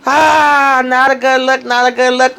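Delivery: high sing-song teasing, a touch of mock pity; tsk-tsk tease. Real, not acted. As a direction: shake your head at her, singing it. Gossipy reaction to Fatima's arrest.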